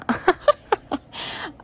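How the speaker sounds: high, hammed-up cackle; a fake evil laugh tossed off as a joke; mock maniacal laugh. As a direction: cackle like a cartoon villain, for fun. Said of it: "laughs maniacally".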